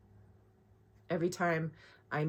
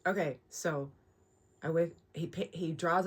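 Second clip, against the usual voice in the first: quiet; 6 dB quieter than usual. Real, not acted. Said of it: "stop talking so loud".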